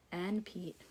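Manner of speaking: put-on hushed reveal to the kids; whisper. Teacher whispering Pete's name to the class.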